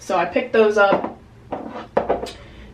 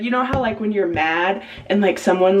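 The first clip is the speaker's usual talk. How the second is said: ordinary talk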